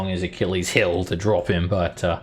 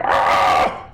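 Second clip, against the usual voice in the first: short pained giant yelp; a quick hurt "Ah!", louder; giant cry; 6 dB louder than usual. Acted. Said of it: "cries a little bit".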